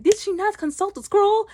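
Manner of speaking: high-pitched mock disbelief; incredulous question. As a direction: act out an incredulous demand, voice shooting up. Retold characters' incredulous question.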